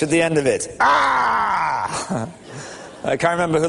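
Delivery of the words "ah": high shouted Ahhh stretched out; acting out a dying man's last cry; long drawn-out Ah. Shown as a demonstration. Preacher acts out a dying man's last word, a high drawn-out shout. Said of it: "capital A, about ten small A's, and an H".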